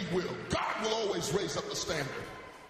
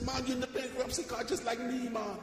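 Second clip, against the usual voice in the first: a hint of a Jamaican accent, pitched higher; Jamaican brother; 5 semitones higher than usual. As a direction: lightly voice the Jamaican stranger in the court. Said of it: "a Jamaican brother".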